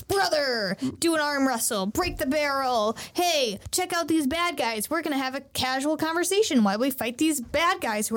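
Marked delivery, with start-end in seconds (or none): none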